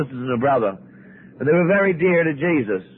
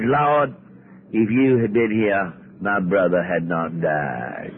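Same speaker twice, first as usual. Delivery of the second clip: low, slow, bitter sarcasm; sarcastic; 8 semitones lower, slower than usual. Acted. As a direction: drip the reproach out slowly and lower. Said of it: "you'd have to hear the sarcasm in her voice".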